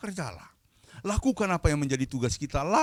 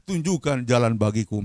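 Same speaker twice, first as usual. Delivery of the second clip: ordinary delivery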